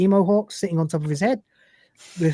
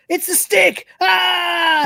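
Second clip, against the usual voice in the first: high frightened yell; startled scream; 12 semitones higher, 4 dB louder, much slower than usual. Retold or imitated. Cat panics at the stick, high frightened yell.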